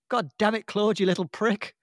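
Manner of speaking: lightly acted Mrs Mack swearing; quoted cursing. Mrs Mack cursing the cat, lightly acted. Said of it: "and she goes".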